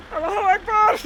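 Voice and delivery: high, loud laughing yell; mock yelling greeting. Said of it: "he just keeps yelling it".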